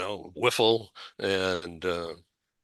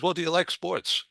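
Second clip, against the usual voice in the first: much higher, lightly voiced coach asking; a hint of the football coach, raised pitch; coach's question; 9 semitones higher than usual. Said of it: "so he says".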